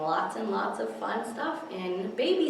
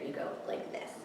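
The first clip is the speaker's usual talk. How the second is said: whisper; 12 dB quieter, faster than usual. Real, not acted. Whisper aside.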